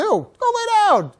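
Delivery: retold dog command. Repeats command given to dog.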